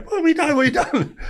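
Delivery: mock panicking women. Women at the bar table after the elbow, high mock panic. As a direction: squeal it like a panicked girlfriend.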